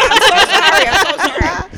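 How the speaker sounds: high, laughing sorry; laughing apology. Retold or imitated. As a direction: giggle through a flustered apology. Apologizing while laughing at herself.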